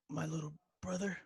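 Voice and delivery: quiet, hesitant line reading; a weak, halting movie line; bad acting. Shown as a demonstration. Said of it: "excuse my acting 'cause it's horrible".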